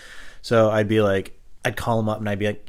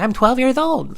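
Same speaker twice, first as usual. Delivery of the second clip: put-on high, squeaky twelve-year-old boy; prank-calling kid John, pitched way up; 5 dB louder, slower than usual. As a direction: play a pesky little kid on the phone. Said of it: "this twelve-year-old kid, John".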